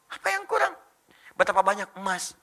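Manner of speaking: ordinary talk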